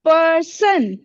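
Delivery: "पर्सन" in two beats, पर्, then सन; split into syllables. Shown as a demonstration. Teacher repeats the word for pupils, "पर्सन" split into two syllables. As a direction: pause inside "पर्सन" after पर्. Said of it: "इसको हम कैसे बोलते हैं".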